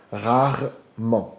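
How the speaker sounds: rarement drawn out very slowly, French r. Shown as a demonstration. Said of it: "preste atenção como eu falo".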